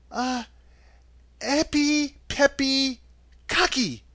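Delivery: put-on high girl's voice, hesitant incantation; halting, high recitation of a charm; Dorothy. A narrator's reading. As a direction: sound out magic words one by one, pitched high. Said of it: "she said".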